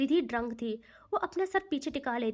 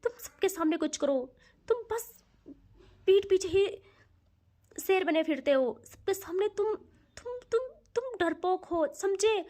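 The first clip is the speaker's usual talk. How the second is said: angry taunt; 5 semitones higher, 3 dB louder than usual. Acted. Ridhi drunk, taunting Soumya.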